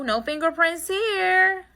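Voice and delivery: cocky slow echo. Shown as a demonstration. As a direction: drawl it out high and pleased.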